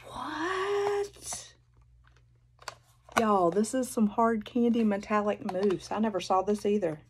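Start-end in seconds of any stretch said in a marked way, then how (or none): 0.1-1.5 high, breathy cry of surprise; real high gasping what; stunned what. Real, not acted. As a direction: squeak it out in disbelief. Gasp at surprise gift.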